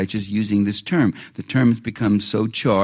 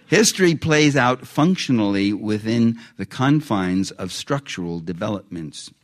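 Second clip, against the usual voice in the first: slower, for note-takers; dictating. Shown as a demonstration. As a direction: read it slowly so they can write. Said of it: "I should have read it more slowly".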